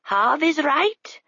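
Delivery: high, very slow bird voice; gently confirm, slowly; high slow raven; raven agreeing. A narrator's reading. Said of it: "said the raven".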